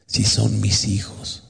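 soft, very slow divine reproach; hushed, solemn voice from heaven; voice of God. Retold or imitated. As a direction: speak gently as God, drawing out each word. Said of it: "vino una voz y me dijo".

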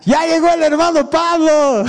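high mocking yell across a hallway; friend's teasing shout from the far end of the hall. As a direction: call it out high across the corridor to tease. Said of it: "desde el fondo del pasillo me grita mi amigo".

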